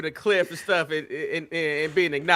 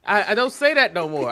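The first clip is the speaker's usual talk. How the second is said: ordinary delivery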